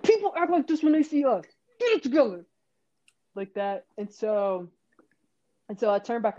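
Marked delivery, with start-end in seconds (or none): 0.0-2.4 retold frantic scold; mock scolding. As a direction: tell off embarrassing friends urgently. Scolding teammates, acted.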